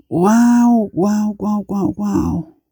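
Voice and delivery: high drawn-out wow, then tapering repeats; amazed wows. Real, not acted. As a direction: marvel aloud, starting high and easing down. Amazed by a death scene. (asombro agudo que se va apagando)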